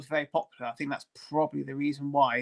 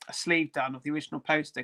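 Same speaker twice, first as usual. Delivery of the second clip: usual speaking voice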